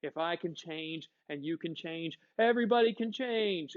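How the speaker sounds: usual speaking voice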